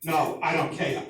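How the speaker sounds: gruff imitation of an adjuster dad; dad's phone voice. Insurance dad arguing with client on phone, brusque imitation. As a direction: cut the caller off, brusque and dismissive. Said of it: "he talked like this".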